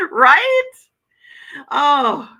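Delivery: high, loud burst of real laughter; laughing. Real, not acted. Vlogger cracks up at a viewer's Kool-Aid joke, laughing loudly. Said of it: "I'm laughing".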